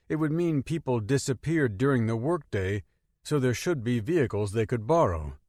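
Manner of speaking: ordinary delivery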